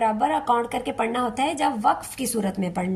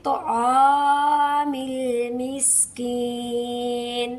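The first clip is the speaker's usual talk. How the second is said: slow chanted Aamil Miskin; tajweed recitation; Quranic recitation; much slower than usual. Shown as a demonstration. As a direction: recite with held madd. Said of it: "लीन कितना?".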